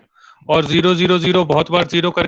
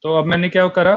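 ordinary talk

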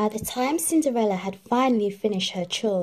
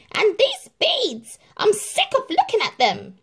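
shouting Drizella; 14 semitones higher, 5 dB louder than usual. A narrator's reading. Drizella shouts about her beads, high loud. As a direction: shout it in disgust. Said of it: "shouted Drizella".

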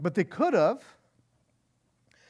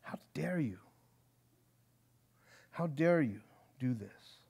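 soft and scornful, dropped low in volume; How dare you said hushed and contemptuous; quiet disdain; 6 semitones lower, 7 dB quieter than usual. Shown as a demonstration. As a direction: let the contempt seep out quietly. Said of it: "because of the disdain or, or contempt and scorn".

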